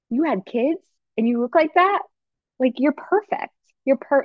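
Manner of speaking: amazed admiration. Acted. Acted praise of a best friend.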